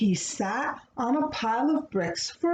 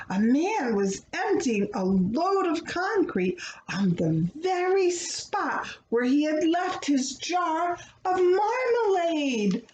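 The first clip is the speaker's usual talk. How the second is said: plain reading voice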